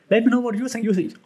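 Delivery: hoarse, strained high voice; voice giving out. Real, not acted. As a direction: push through a cracking, tired voice. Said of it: "I'm losing my voice".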